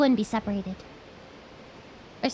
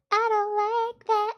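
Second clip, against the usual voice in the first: higher, sugary little cutesy voice; cutesy baby talk; 7 semitones higher, slower than usual. Shown as a demonstration. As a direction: coo it like a small child. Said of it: "Only cutesy voice".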